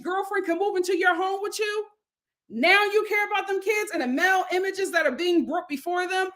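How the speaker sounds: high, incredulous scolding; indignant challenge. Acted. Host telling off Lenny about the kids, indignant.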